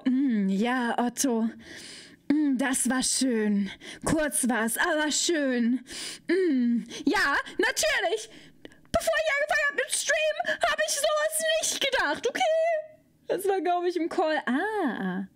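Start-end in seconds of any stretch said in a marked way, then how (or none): 0.0-5.8 suggestive voice. Pretend. Reads a suggestive chat message. 6.3-12.4 high squealing mock outrage. Mock-outraged read of chat line.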